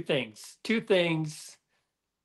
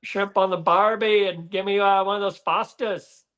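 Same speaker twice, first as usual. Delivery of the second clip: put-on a hammy, higher Australian accent through a laugh; Aussie accent; 4 semitones higher than usual. Doing his Aussie accent for the Australian host, a hammy higher Aussie accent. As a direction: ham up the Aussie clichés. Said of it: "You, you're not too bad".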